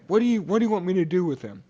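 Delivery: fast, high-pitched retold question; exasperated Pilate. Pilate retold, pressed by crowd.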